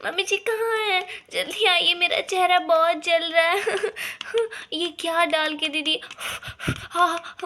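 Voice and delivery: burning face, calling for help; mock agony, calling mother-in-law; pained crying out.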